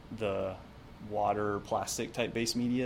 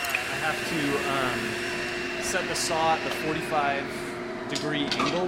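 raised voice over a loud saw; shouting over table saw; 3 semitones higher, 3 dB louder than usual. Real, not acted. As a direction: call out over shop noise. Talking over a running saw.

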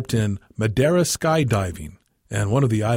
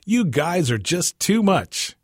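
exclaiming; 7 semitones higher, slower than usual. A narrator's reading. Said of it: "Gerald exclaimed".

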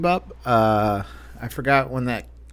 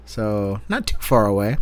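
normal talking voice

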